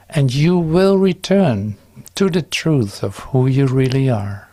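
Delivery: ordinary talk, nothing marked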